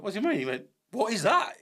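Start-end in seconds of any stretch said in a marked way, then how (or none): 0.0-0.4 surprised quote. Retold or imitated. Retold shock at friend's question. 0.9-1.5 excited quote. Retold or imitated. Friend's excited question, retold.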